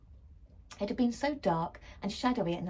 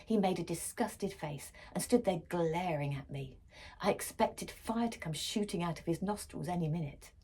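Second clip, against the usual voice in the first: ordinary delivery, nothing marked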